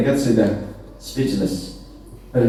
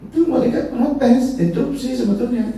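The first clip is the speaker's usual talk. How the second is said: protesting angels; 11 semitones higher than usual. Retold or imitated. Preacher voicing angels' protest.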